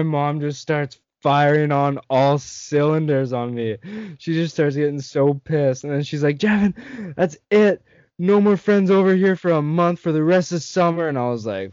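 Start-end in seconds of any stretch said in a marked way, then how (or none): 6.4-11.1 mock angry mom. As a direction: imitate mom handing out punishment. Mom grounding him, retold.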